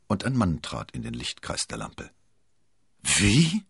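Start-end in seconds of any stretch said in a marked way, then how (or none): 3.0-3.6 verblüffter, lauter Ausruf; ungläubig und höher herausrufen; höchst verblüfft. A narrator's reading. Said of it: "rief Milady aufs Höchste verblüfft". (völlig verdutzt)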